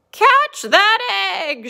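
high, loud, stretched storybook shout; drawn-out acted cry; calling out. A narrator's reading. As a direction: call after the runaway egg as Sheep. Sheep calls after rolling egg.

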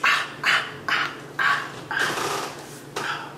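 unvoiced, fading pained breaths; mock shaky gasps after the swab; breathless panting. Retold panting after pain.

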